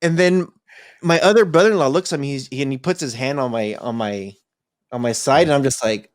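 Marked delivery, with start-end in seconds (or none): none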